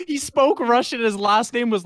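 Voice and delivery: high, loud, indignant rebuttal; insistent protest. Real, not acted. Co-host defending that the kid was Russian, insistent protest. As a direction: protest with pitch jumping way up. Arguing back about nationality.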